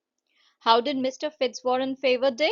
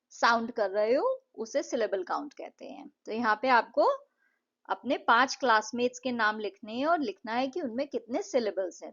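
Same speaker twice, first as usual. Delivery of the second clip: usual speaking voice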